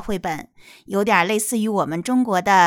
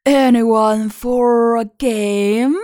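slow, rasping and hopeful wolf; hoarse but hopeful voice; 4 dB louder, much slower than usual. Acted. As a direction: rasp out the hopeful invitation. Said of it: "said a hoarse but hopeful voice".

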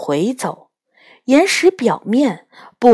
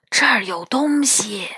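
barely audible; 5 dB quieter than usual. Acted. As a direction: breathe it out almost silently. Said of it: "元宝用极低的声音说".

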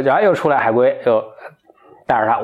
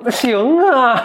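高声痛快地一口答应; 喜出望外地连忙点头; 爽快答应; 14 semitones higher, 3 dB louder, much slower than usual. Acted. 小伙子爽快答应娶龙女. (高兴地一口答应)

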